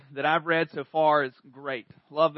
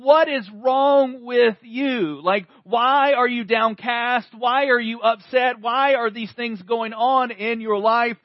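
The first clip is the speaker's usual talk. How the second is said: psalmist scolding himself; 4 semitones higher, 6 dB louder, slower than usual. Retold or imitated. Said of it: "this is the psalmist going".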